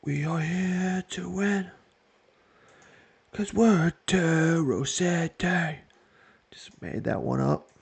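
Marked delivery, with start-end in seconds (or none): 0.0-5.8 slow, high put-on British accent; mock British accent. Team manager's interview, in a mock British accent. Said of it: "best British accent".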